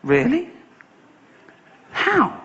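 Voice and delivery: squeaky high astonishment; amazed polar bear. Retold or imitated. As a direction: gasp it in disbelief.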